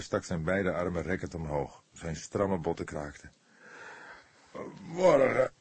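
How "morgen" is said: higher, louder weary groan. A narrator's reading. Tired old cook stretching late at night, groaning. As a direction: groan it out while stretching stiff arms. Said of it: "kreunde hij".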